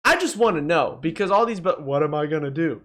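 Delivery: ordinary talk, nothing marked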